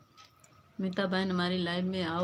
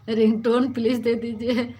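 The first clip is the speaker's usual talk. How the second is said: "Ringtone please दे दीजिए" said louder through a laugh; laughing repeat; 3 semitones higher, 9 dB louder than usual. Real, not acted. Repeating her mixed-up word to viewers, laughing loudly. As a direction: laugh at her own slip while asking again.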